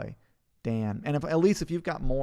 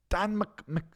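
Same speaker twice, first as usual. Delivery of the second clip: high-pitched attempt at Scots; mock Scottish accent; 8 semitones higher, slower than usual. Streamer retrying Dan's surname, mock Scottish accent. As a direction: start the name in put-on Scottish.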